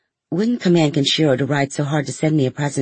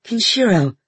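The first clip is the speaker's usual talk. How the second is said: high, falling cry of reproach; appalled cry; 8 semitones higher, 3 dB louder than usual. A narrator's reading. Sister rebukes brother over the dead horse, aghast. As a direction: call out the name, aghast.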